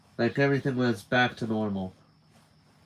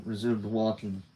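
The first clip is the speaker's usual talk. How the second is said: usual speaking voice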